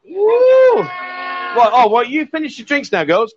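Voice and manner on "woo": high, loud cheering whoop; big party whoop. Real, not acted. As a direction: let out a long high "woo". Cheering during a stream.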